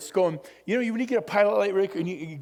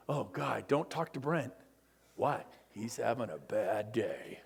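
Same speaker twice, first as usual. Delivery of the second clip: mock gloomy low; 10 semitones lower, 7 dB quieter than usual. Speaker mocking moody people, low mopey whisper. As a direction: sink it down like a sulking coworker.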